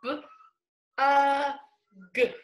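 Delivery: each sound said on its own, B, uh, g; sounding out letters. Shown as a demonstration. Teacher sounding out bug for young kids. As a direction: stretch out each sound separately. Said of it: "Now we're gonna say the whole word".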